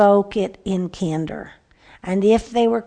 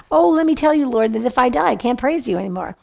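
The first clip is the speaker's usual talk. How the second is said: high, loud mock plea easing down; animated, higher-pitched mock protest; playful pleading; 4 semitones higher, 3 dB louder, faster than usual. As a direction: bargain with God in a hammed-up plea. Playfully paraphrases David pleading with God.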